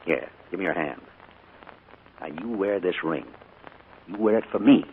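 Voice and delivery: soft and low; tender. Acted. Uncle giving his niece a ring, soft and tender. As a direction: hand it over gently.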